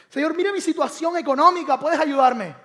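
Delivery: loud, high begging cry; desperate plea. Acted. Believer begging the Lord about money, loud and high.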